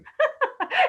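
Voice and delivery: high amused laugh. Real, not acted. Amused by husband's retold line.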